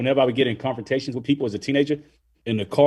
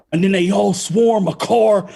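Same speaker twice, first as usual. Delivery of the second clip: higher, louder, slow imitation of an amped storyteller; amped-up retelling; 9 semitones higher, 5 dB louder than usual. Coworker recounting the riots, imitated amped delivery. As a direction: imitate someone getting worked up over his story. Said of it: "he was getting very amped".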